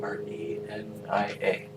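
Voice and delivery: whispered spelling; whispering. Real, not acted. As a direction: breathe the letters out. Spelling out name quietly.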